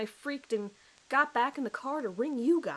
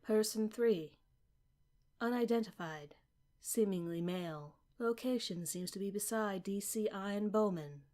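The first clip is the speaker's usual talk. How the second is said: formal log reading; 5 dB quieter than usual. Acted. Recorder log read.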